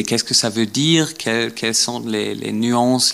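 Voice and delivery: normal talking voice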